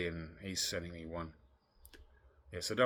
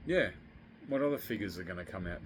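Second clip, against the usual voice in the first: ordinary talk, nothing marked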